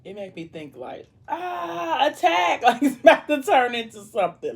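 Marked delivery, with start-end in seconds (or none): 1.3-2.6 high, strained mock scream of alarm; mock attack cry. Pretending the lobster tail attacks her, playful high cry. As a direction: shriek like the lobster is lunging at you.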